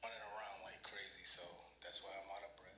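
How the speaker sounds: quiet rushed repeat to someone nearby; quiet aside. Real, not acted. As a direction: toss it off under your breath.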